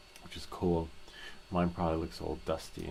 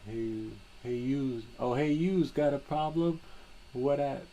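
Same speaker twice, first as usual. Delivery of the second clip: much higher, louder hammed-up New Yorker; a cartoon Brooklyn tough asking for trouble; New York accent; 8 semitones higher, 4 dB louder, slower than usual. Mocking a New Yorker for the chat, hammed-up New York accent. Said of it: "That's like New York".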